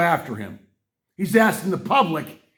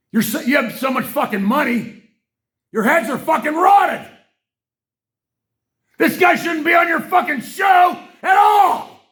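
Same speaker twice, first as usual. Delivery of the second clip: much higher, louder yelling; furious shout; 12 semitones higher, 6 dB louder than usual. Real, not acted. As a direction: bellow your rage at him. Furious rant at Musk.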